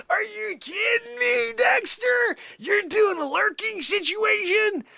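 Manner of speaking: high, cracking mock-outraged shout, slowed; exasperated cry. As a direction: wail it at Dexter in disbelief. Ranting at lurking caller Dexter.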